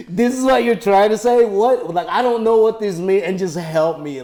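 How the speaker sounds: high, loud, exasperated mock rant. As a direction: blast it like a fed-up friend tearing into the joke. Mock-quoting a friend's harsh notes.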